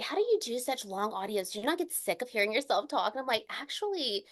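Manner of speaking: normal talking voice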